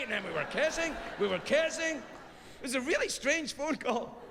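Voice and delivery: usual speaking voice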